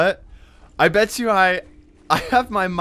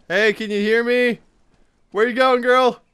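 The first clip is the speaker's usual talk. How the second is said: higher, raised voice to a stranger; calling out; 6 semitones higher, 5 dB louder than usual. Real, not acted. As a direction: call after someone running off. Calling to another player.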